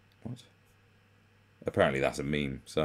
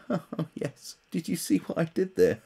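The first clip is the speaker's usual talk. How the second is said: higher, laughing, pleased-with-itself voice; smug idiot impression; 10 semitones higher than usual. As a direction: ham up a self-satisfied wit. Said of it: "they go".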